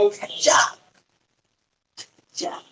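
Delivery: unvoiced scissor sound, soft; scissors snip. Shown as a demonstration. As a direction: mimic scissors cutting with breath. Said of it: "कैंची लाके इसको काट देंगे".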